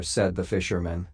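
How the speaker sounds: usual speaking voice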